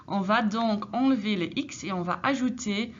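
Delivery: normal talking voice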